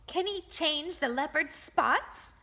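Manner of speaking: high, scornful jeer; mock the very idea. A narrator's reading. The woman mocking the boy's faith, jeering. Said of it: "the woman jeered".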